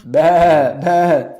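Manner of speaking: mock bleating bah bah; playful sheep bleat.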